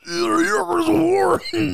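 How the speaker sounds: high, slow, drawn-out mock Joe Rogan; goofy exaggerated impersonation; Joe Rogan impression. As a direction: ham up a famous host greeting a fan.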